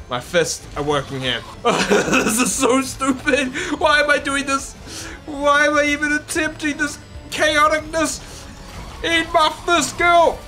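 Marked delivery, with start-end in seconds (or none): 1.6-8.2 laughing frustration. Real, not acted. Laughing frustration at hard fight. 9.0-10.4 high shouted taunt; battle cry. Pretend. Punching boss, battle cry.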